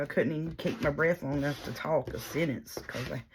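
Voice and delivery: ordinary talk, nothing marked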